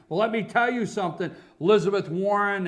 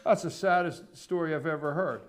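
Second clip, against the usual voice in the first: ordinary talk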